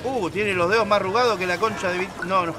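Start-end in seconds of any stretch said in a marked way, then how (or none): none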